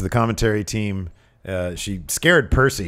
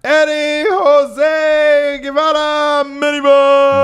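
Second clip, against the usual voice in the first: very high, drawn-out shout; shouted name announcement; 8 dB louder, much slower than usual. Shown as a demonstration. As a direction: bellow the name slowly at a high pitch. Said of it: "you say Eddie Jose's n-, name".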